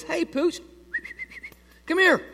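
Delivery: high, sing-song call to a dog; coaxing dog call. Retold or imitated. Friend calls the dog over.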